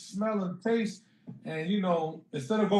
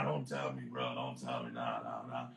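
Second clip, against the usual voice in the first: low disbelieving denial; 9 dB quieter than usual. Retold or imitated. Disbelief on realising he has covid.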